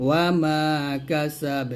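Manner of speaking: slow, long held vowels, bouncing final b; Quran recitation with qalqalah. Shown as a demonstration. Teacher models stopping on the verse, slow recitation. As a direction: recite slowly, echo the b of কাসাব. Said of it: "যদি এখানে থামেন তাহলে বাটা কলকল হবে".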